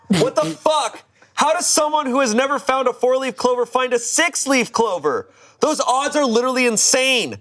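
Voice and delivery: high-pitched, incredulous rant; outraged disbelief. Acted. Jealous poster reacts to girlfriend's find, high incredulous outrage. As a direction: read it high, acting utterly indignant.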